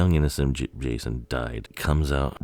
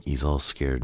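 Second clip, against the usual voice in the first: usual speaking voice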